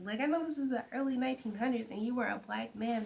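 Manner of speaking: ordinary talk, nothing marked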